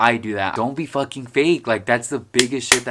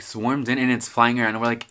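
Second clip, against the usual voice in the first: normal talking voice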